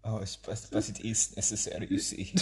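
hammed-up German accent. Podcaster insisting to co-host it is necessary, German accent. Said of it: "I will have a German accent".

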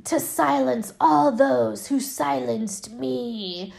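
slow, drawn-out villain doll voice; eerie, deliberate doll pronouncement; demonic doll. Retold or imitated. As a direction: imitate an evil doll, savoring each word slowly. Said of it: "the doll is like".

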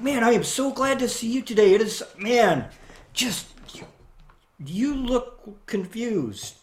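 overjoyed then gently teasing; beaming delight. Retold or imitated. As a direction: grin through it, then ease off.

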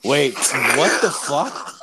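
loud, high, drawn-out disbelief; mock shock. Driver hears his passenger's confession, acted shock. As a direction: stretch it out, stunned and incredulous.

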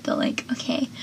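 a quick dry aside breathed under the breath; whispered aside. Real, not acted. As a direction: drop to a near-silent whisper for the last words.

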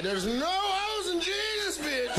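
slow, high, yelled correction; mock scolding shout. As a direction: shout it at her, drawn out. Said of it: "And I'm like".

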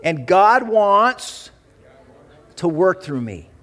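loud, slow declaration for the church to repeat; leading the congregation, loud and deliberate; call and response declaration. Shown as a demonstration. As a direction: proclaim it so the church can echo it. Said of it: "repeat out loud after me".

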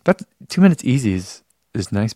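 usual speaking voice

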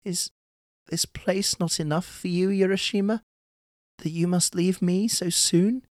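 high-pitched, sorrowful princess voice; sad, weeping plea. A narrator's reading. Otohime begs her husband to stay, sad and pleading. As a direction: plead tearfully, pitched far above the narration. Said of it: "began to weep, saying sadly".

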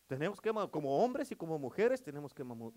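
usual speaking voice

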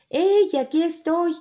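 llamada alta, lenta y fuerte; grito desde el cielo. A narrator's reading. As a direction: grita desde lo alto para que lo encuentren. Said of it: "una voz que les grita". (grito lejano desde el cielo)

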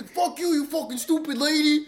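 high, loud squeaky imitation of a friend's tough talk; mock tough guy. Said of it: "Pepsi thinks he sounds tough as hell".

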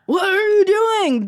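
high, loud, drawn-out complaint; mock pained protest. Performer retelling a protest to her scene partner, high mock wail. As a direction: wail it slowly in exaggerated protest.

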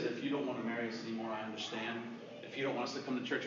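low, soft, quick words; retold hurt, quietly offering to leave; subdued resignation. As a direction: give up softly. Retold tearful woman offering to leave.

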